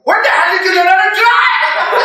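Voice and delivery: high, drawn-out mock yell; hammed-up driving quarrel; bickering spouse. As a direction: screech it at your spouse behind the wheel. Parents bickering in car.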